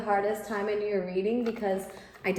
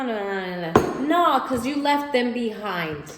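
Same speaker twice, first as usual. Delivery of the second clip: normal talking voice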